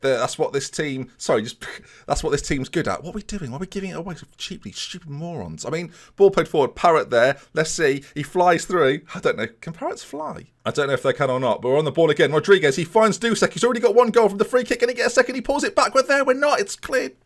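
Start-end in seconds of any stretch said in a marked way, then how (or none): 3.0-5.6 soft, high, fast grumble; muttered frustration. Real, not acted. Gamer grumbles at his team's giveaway, fast and soft. 13.5-17.2 much higher, fast commentary; rising excitement. Real, not acted. Gamer narrates a late chance, high and fast.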